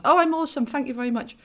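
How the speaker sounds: high, loud playful brag; bright mock-boast. Real, not acted.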